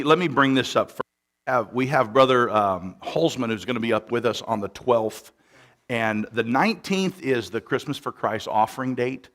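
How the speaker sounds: full-force church announcement; preaching voice. Acted. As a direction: project it from the pulpit. Preaching into microphone.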